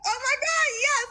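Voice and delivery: high crying shout of joy; overjoyed. Retold or imitated. Telling the host about Kelly, a high tearful yes. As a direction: squeal it through happy tears. Retold ecstatic reaction to plan.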